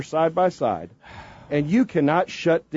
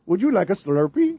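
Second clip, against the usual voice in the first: badly faked Indian accent; 3 semitones higher than usual. Host repeating the line on request, poor Indian accent. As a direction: attempt a mock Indian accent. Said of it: "do an Indian accent".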